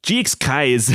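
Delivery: put-on a quick, laughing South African accent slipped in by mistake. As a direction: start reading in a South African accent, then crack up. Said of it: "That was a South African accent you started".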